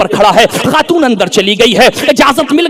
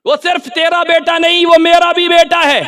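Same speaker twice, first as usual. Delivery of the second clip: raised, sustained preacher's chant; high ringing repeat; 4 semitones higher, 4 dB quieter than usual. Acted. Preacher proclaiming the quote to the crowd, high ringing. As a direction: lift it high and hold the pitch.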